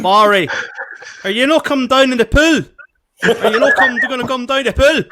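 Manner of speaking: shouted call across the pool, high and loud. Retold or imitated. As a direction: yell it out to him at the pool. Calling to Barry at hotel pool.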